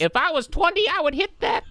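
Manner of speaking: high creaky kid playing grandpa; boy voiced like an old man. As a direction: lech it up like a tiny old man. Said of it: "He talking like he's an old man".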